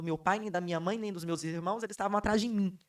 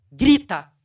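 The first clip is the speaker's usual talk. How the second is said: a sudden, much louder one-word shout; commanding shout; 16 dB louder than usual. Retold or imitated. God ordering her to scream on stage, loud commanding shout. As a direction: bark the order like a voice from above. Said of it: "Deus virou pra mim e falou comigo assim".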